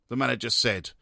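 normal talking voice

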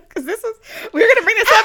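fast, high laughing words; cracking up while talking. Real, not acted. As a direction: blurt it out through real laughter. Cracking up at guest's answer.

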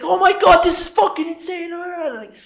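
very high, loud, shrieking vlogger excitement; overdramatic Casey. Retold or imitated. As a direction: play up the thrill for the camera. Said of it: "he's so overdramatic about it".

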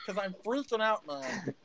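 a little gnome spiralling into a crisis; panicky gnome Stitch. Acted. Gnome Stitch freaks out that a stranger guessed his name.

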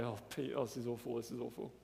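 low, soft self-pitying grumble; grumbling to himself. Retold or imitated. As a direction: mutter it low under your breath. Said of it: "And I grumble away".

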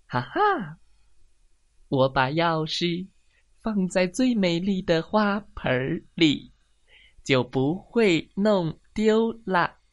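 chuckle, then savour each word as a pleased hen; self-satisfied hen. Acted. Hen pleased with her hiding place.

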